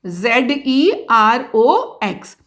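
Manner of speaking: जेड ई आर ओ x spelled letter by letter, slowly; spelling it out slowly. Shown as a demonstration. Teacher spells xerox for the class, slow and even. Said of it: "xerox".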